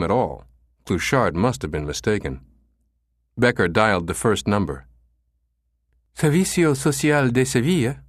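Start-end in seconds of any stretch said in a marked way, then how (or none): 6.1-8.0 higher, pleasant female voice; pleasant receptionist. A narrator's reading. Escort agency receptionist answering Becker's call, pleasant. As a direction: answer the phone sweetly as a receptionist. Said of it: "a pleasant female voice answered".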